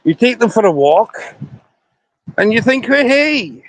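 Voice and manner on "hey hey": ordinary delivery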